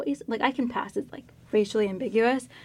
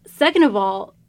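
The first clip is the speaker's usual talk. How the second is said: sassy retort; 5 dB louder, slower than usual. Retold or imitated. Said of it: "And I'm like".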